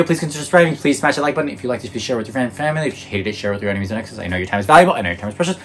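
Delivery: ordinary talk, nothing marked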